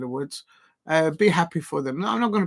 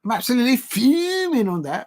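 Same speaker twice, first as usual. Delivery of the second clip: high, put-on Scouse twang on "I'm absolutely fuming on that"; Liverpool accent; 7 semitones higher, 3 dB louder than usual. Shown as a demonstration. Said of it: "I'm actually gonna do it with a Liverpool accent".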